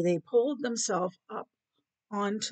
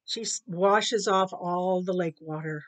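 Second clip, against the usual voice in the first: plain reading voice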